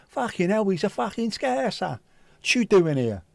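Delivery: high-pitched mock Cockney outburst; hammed-up Cockney heckle. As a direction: imitate an incredulous Cockney, voice pushed up high. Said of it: "a load of these cockneys".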